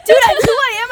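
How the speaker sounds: loud, high, excited outburst; excited shout. Real, not acted. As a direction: blurt out the realization. Realizes he is being hacked.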